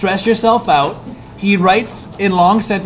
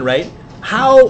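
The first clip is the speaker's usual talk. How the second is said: ordinary delivery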